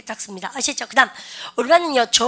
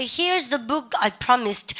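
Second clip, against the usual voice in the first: very slow model sentence; slow model read; much slower than usual. Shown as a demonstration. Teacher setting up the cheers example slowly. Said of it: "근데".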